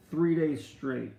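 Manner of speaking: ordinary talk, nothing marked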